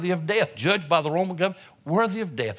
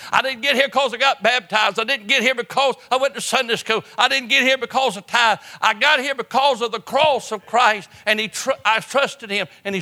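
higher and louder, hammering each line; fervent preaching; 8 semitones higher, 5 dB louder than usual. Acted. Preacher voicing the saved thief to the congregation, full-force preaching.

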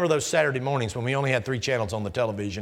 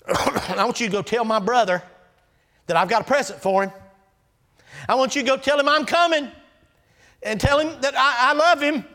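high, loud, pleading plea to his brother; desperate brother sending word of love; Jacob pleading; 11 semitones higher, 6 dB louder than usual. Acted. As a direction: climb higher and louder as he begs to make peace. Jacob voiced sending messengers.

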